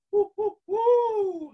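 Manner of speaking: high, slow hooting hoo; owl hoot. Shown as a demonstration. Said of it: "Try it".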